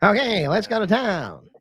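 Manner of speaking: high slow country bumpkin; high slow yokel voice. Acted. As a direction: draw it out like a bumpkin. Acted yokel.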